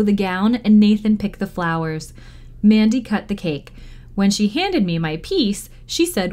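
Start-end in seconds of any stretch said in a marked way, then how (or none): none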